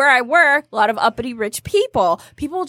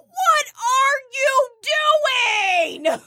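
yelling out the window; 11 semitones higher than usual. Retold or imitated. Driver yelling through her open window, exasperated. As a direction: stretch each word in mock outrage.

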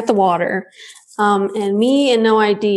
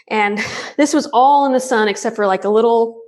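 ordinary delivery

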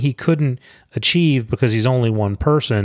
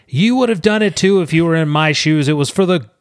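self-justifying villain; 6 semitones higher than usual. Retold or imitated. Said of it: "he's like".

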